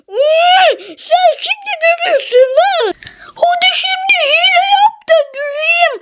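high buddy voice daring in a whisper; friend's playful threat. Acted. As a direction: dare him to cheat now.

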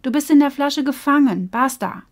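loud firm verdict; scolding. Acted. Bo scolds the trapped fish, loud and firm. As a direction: lay down the hard truth. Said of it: "schimpfte Bo".

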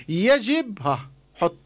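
normal talking voice

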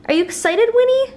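high rising coo; excited puppy talk. Real, not acted. Owner hyping her puppy about the vet, high. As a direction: pitch it up with excitement.

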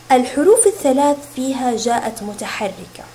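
normal talking voice

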